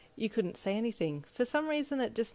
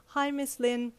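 a little higher, eager but shy; shy excitement; 7 semitones higher, slower than usual. Acted. Ichiro greeting his old friend's mum with shy excitement. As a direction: greet her, bashful and thrilled. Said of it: "said with shy excitement".

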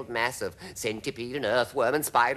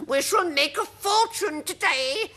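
very high, drawn-out character voice; slow, high-pitched gloating aunt; Aunt Spiker; 4 dB louder than usual. Acted. Aunt exulting over making a fortune, high character voice. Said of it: "Aunt Spiker was saying".

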